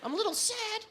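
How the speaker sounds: high mocking girly voice. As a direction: parody the ex's chirpy updates. Mocking ex's Facebook updates.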